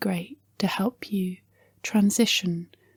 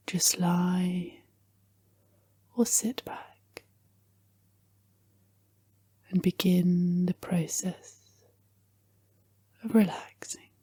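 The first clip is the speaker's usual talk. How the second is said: guided meditation; 9 semitones lower, 8 dB quieter than usual. Acted. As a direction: gently guide the listener into relaxation, phrase by phrase.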